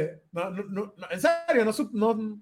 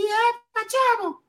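put-on much higher, squeaky boyish greeting; [name]'s high voice; 12 semitones higher, much slower than usual. [name] picking up Miguel's call, in a much higher child's voice. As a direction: answer the phone like a boy whose voice hasn't broken. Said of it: "todavía hablaba así".

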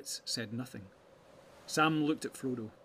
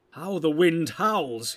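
loud, slow cry of alarm; raised, urgent exclamation; cried out; 5 semitones higher, 11 dB louder, much slower than usual. A narrator's reading. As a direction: cry it out, higher and stretched. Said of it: "he cried".

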